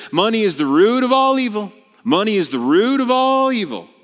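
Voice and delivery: mock preacher declaring "Money is the root of all evil"; emphatic misquote. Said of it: "Sometimes I would say it like this".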